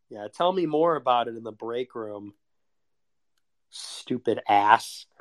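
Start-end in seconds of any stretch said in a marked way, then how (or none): none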